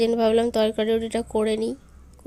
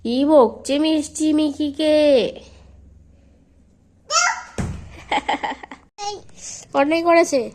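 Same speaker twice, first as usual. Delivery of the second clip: louder, slow, drawn-out mock surprise; mock-hurt coaxing; 3 semitones higher, 6 dB louder, slower than usual. Real, not acted. Mother teasing child who scolds. (আদুরে ঠাট্টার সুরে)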